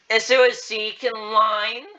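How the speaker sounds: loud, slow, high call. Acted. Beth calling Zeke from the line, calling out. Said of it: "Beth finally called out".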